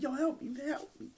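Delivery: mock panicked plea; mock cry for help. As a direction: plead for rescue in a strangled high voice. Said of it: "like, Jay, like".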